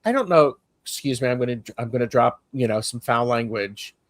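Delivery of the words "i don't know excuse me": ordinary talk, nothing marked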